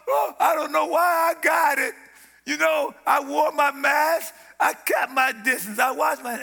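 loud, high, hammed-up whining shout; mock complaining dier. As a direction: mimic a scared sick person yelling excuses. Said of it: "die talking like that".

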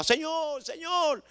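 very high, restrained mock holler; retold crying out. Believer clamoring to God at dawn, imitated high cry. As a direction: call out high to God without real volume. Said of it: "eran griterías constantes, gritaba".

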